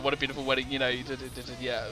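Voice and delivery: ordinary talk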